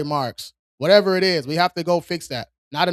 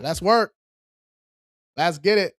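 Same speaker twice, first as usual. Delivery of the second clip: ordinary talk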